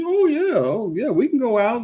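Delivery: high, gushing mock woman's voice; mock excited woman. Vlogger imitating a woman dazzled by cash. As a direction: gush like she just saw the money. Woman impressed by money wad.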